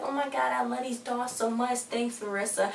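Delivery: high, put-on sweet thanks; fake gushing. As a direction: imitate someone faking delight over a gift. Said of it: "she was so fake".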